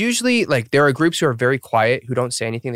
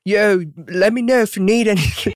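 higher, hammed-up Aussie accent, "Yo" thrown in; bad Aussie accent; 8 semitones higher than usual. Host redoes Chan's line, hammed-up Aussie accent. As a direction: overdo the Aussie accent and laugh it off. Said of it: "Sorry, that's horrible".

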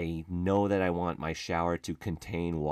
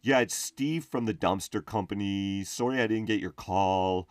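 a hint of a frazzled, louder phone guy; dumpster guy voicemail; 3 semitones higher, 3 dB louder than usual. Steve leaving a voicemail about being busy, lightly frazzled. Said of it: "and he's like".

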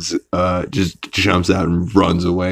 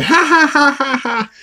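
high, hammed-up ha ha ha; mock laughing fit. Chris amused by the jingling, high mock laughter. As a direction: fake a rolling-on-the-floor belly laugh. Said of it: "starts rolling on the floor and laughing".

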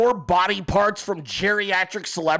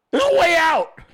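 higher, louder crying yell; mock desperate shout; 8 semitones higher, 5 dB louder than usual. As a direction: yell like someone trapped, begging to get out. Trapped person yelling for escape.

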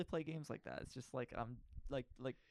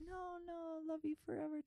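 much higher, softer mock-doting voice; mock sweet boyfriend; 5 dB quieter than usual. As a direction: coo it like a cheesy lover. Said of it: "type of deal".

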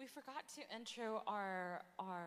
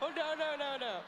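loud, high laughing refusal; high, cracked-up objection; laughing protest; 8 semitones higher, 10 dB louder than usual. Real, not acted. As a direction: protest helplessly through real laughter. Laughing protest at partner's joke.

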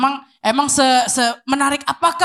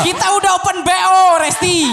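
sassy drag queen; 8 semitones higher, 4 dB louder than usual. Acted. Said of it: "Lu mau suara banci kami keluar".

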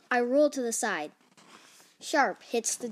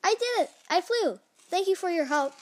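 high, excited exclamations; triumphant Piggy; 7 semitones higher, 4 dB louder, faster than usual. Acted. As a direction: celebrate a success, pitched high. Piggy celebrates having flown.

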